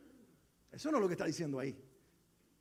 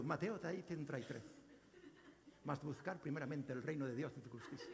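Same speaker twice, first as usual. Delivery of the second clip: lower, softer mock Castilian narrator reading the verse reference; Spain Spanish audio Bible reader; 7 semitones lower, 11 dB quieter than usual. Pastor mimics his Bible app reading in a Spain Spanish accent, low and soft. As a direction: imitate the Bible app's Spain-Spanish voice, deep and hushed. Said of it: "tiene hasta acento español".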